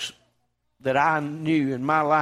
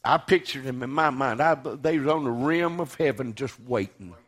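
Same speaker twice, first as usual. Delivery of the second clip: normal talking voice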